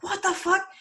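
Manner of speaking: high-pitched incredulous outburst; mock outrage. Storyteller as tricked Fenrir to Odin, mock outrage. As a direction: squawk it in hammed-up disbelief.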